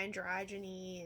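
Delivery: usual speaking voice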